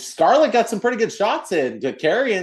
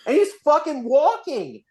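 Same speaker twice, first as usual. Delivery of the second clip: high, incredulous rant at the wrestler; mock outrage, high and rising; exasperated disbelief; 9 semitones higher than usual. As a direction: vent in disbelief, voice pitched way up. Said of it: "And it's like".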